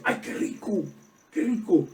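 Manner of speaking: imitating a friend's high, delighted "qué rico"; delighted exclamation. Preacher imitating a dear friend receiving a gift, delighted. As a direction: mimic someone tasting a treat with pleasure. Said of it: "con una cara de satisfacción".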